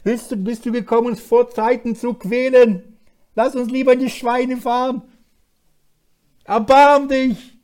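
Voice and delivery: high, loud begging cry, slowing at the end; demons crying out. Retold or imitated. Preacher voicing demons screaming at Jesus' feet, loud high pleading. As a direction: plead for mercy like a cornered demon. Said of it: "schrien sie".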